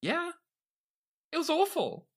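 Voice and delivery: high mock-horrified verdict on a bad book; mock disgust. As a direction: squeal it like it scarred you.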